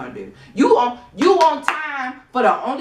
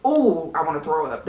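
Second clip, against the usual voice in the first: ordinary talk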